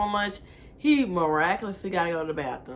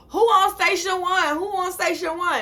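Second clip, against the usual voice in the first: calling out; 12 semitones higher, 6 dB louder than usual. Retold or imitated. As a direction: call out across the station. Imitating manager on headset.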